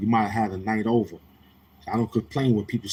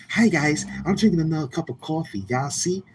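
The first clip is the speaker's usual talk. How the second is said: higher, mocking imitation of a viewer; mock chatter voice; 7 semitones higher than usual. Mocking a viewer who keeps posting about coffee. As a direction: sneer it like a corny streamer greeting.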